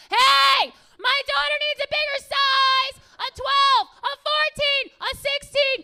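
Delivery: very high, full-volume holler; yelling out. Acted. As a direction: mom broadcasting across the whole store. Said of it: "you kind of yelled out".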